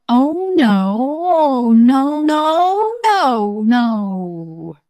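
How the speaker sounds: high, loud, drawn-out wailing; long swooping cries of protest; tickled tiger's wail. Acted. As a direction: wail helplessly as you're tickled, easing off at the end.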